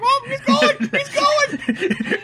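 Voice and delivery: high acted cry; hammed-up high exclamation, near laughing; mock excited onlooker. Acting out watching the jumper.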